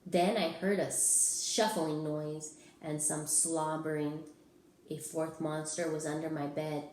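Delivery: usual speaking voice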